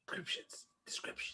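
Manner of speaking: eerie whispered echo. Pretend. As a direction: breathe the word like a ghost.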